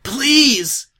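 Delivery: high, loud desperate shout. A narrator's reading. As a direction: scream the plea. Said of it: "Twilight shouted".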